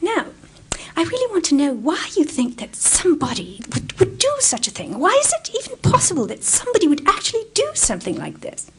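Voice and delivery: mimicking the BBC producer's British accent, rising high; his accent. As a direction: put on his accent, climbing in pitch. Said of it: "I will do his accent".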